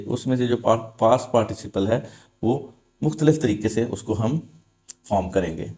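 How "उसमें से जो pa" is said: ordinary talk, nothing marked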